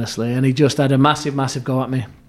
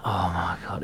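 sinking dread; 7 semitones lower, 10 dB quieter than usual. Retold or imitated. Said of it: "it's a sinking feeling".

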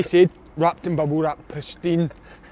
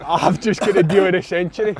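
laughing brag; 3 semitones higher, 7 dB louder than usual. Retold or imitated. Bragging to wife about TV deal.

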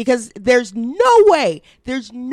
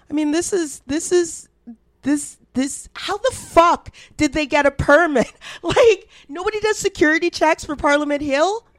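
incredulous; 7 semitones higher than usual. Real, not acted. Disbelief at group getting permit.

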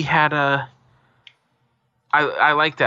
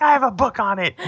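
smug high retort; 11 semitones higher than usual. Retold or imitated. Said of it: "I was like".

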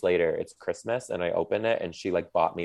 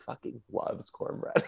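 hushed; 5 semitones higher, 6 dB quieter than usual. Retold or imitated. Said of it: "Morgan's sister's like".

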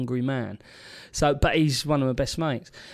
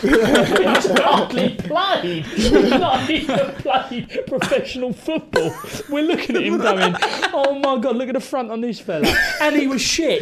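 talking through real laughter, pitched up; high, laughter-filled storytelling; laughing; 8 semitones higher, 4 dB louder, slower than usual. Real, not acted. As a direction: crack up while telling the story. Teller cracks up at own story.

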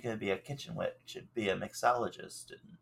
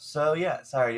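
ordinary delivery

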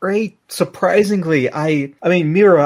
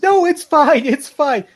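much higher, strained and louder; tearful denial; 12 semitones higher, 5 dB louder than usual. Acted. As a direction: insist you're fine while breaking. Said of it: "And Lemon says".

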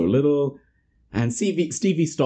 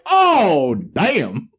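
surprised; 5 semitones higher, 5 dB louder than usual. Retold or imitated. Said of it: "And you're just like".

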